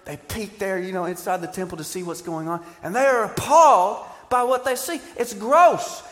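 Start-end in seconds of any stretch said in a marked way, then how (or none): 2.8-6.0 high, loud, scandalized priest voice; mock horror. Preacher mocking the scandalized priests, in loud horror. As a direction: play the priests' prudish disgust. Priests horrified at temple scene.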